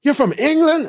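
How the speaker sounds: high loud rapid bragging; excited shout. Retold or imitated. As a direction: boast about calling it right. We had a bet.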